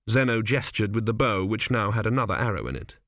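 plain reading voice

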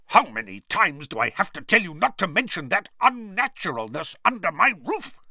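high, tense, outraged scolding; furious uncle laying down the law; angry hiss. A narrator's reading. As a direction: scold as if barely holding back fury. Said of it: "he hissed".

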